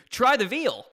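high, loud punchline; catchphrase delivery. Retold or imitated. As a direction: throw out the catchphrase like a showman. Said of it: "the first person to say to you".

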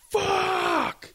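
put-on high drawn-out yell of a drunk; retold drunk yell. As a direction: bellow it like a drunk in the street. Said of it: "top of his lungs".

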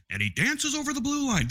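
hockey announcer. Retold or imitated.

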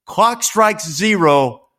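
excited storyteller build-up; dramatic play-by-play. Acted. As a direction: call it like a late-game broadcast. Dramatic game recap.

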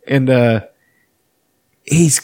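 usual speaking voice

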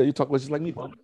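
high-pitched mock English accent. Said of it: "you can hear his accent come out".

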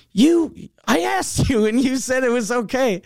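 high, put-on voice for the mechanic; mechanic's voice. Retelling the mechanic blaming him, in a high put-on voice. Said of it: "And he goes".

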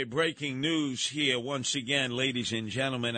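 usual speaking voice

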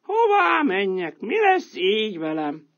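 a stray animal wondering where to go; worried animal voice. Acted. Character laments, no ideas left.

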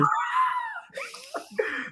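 high shrieking laugh. Real, not acted. Laughs at teasing.

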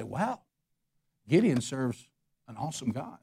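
softly, in wonder at God; hushed awe. Retold or imitated. Pastor voicing crowds amazed at God, hushed awe. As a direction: breathe it out like people stunned.

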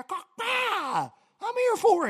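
squawking high yell, then hyped up; hyped fight cry. Pretend. Angler pumped for a fishing fight, high squawking whoop. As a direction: whoop like you're jumping into a brawl.

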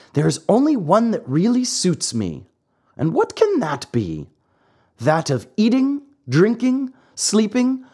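a lightly voiced naughty puppet ordering him out; cheeky marionette. Pinocchio's held voice.

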